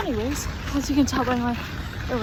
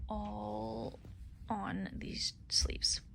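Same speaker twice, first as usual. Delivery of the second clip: soft whispered aside; whispering; 2 semitones lower, 17 dB quieter, much slower than usual. Real, not acted. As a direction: drop to a whisper. Whispered aside while showing.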